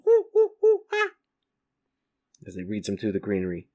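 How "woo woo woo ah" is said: very high, loud hooting bird cry; toucan call. Acted. Toucan hoots loudly from the close-up, very high. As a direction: whoop like a jungle bird. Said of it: "toucan who goes".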